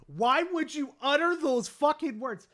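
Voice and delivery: ordinary talk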